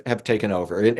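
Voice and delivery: ordinary talk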